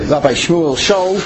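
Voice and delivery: ordinary talk, nothing marked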